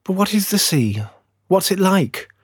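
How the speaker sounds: high-pitched little tree voice; curious little fir. A narrator's reading. Little fir asking the stork about the sea, high and curious. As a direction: ask it high and eager, like a small sapling.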